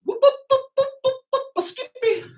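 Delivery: very high rhythmic whoops; falsetto whooping. Acted. MC stopping on H, high falsetto whoops. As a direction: squeak out whoops on the beat. Closing the chant with nonsense whoops.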